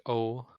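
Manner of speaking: O like the English letter O; long oh vowel. Shown as a demonstration. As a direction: say O round and long. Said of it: "like the letter O in English".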